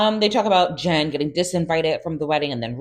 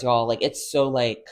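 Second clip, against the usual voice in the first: ordinary talk, nothing marked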